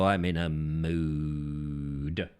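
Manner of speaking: low, hugely drawn-out mock brooding; theatrical low groan of a line; hammed-up moodiness. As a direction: stretch it out deep and slow, wallowing in it. Repeats the mood line theatrically.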